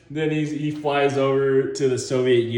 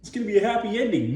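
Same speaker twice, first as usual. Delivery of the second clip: higher, fast hopeful chatter; naive audience; 8 semitones higher, faster than usual. Retold or imitated. Audience expecting a happy ending, fast hopeful gush. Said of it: "'cause they're like".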